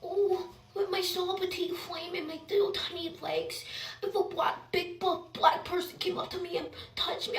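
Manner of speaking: small, timid mocking voice; mock scared girl. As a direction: play a tiny frightened girl, mockingly.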